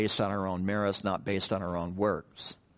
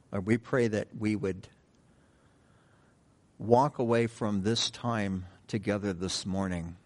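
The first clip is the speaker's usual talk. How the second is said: prayer; 2 semitones lower, 3 dB quieter than usual. Real, not acted. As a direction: pray aloud gently, phrase by phrase. Leads a prayer.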